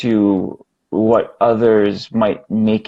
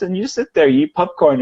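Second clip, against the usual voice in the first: usual speaking voice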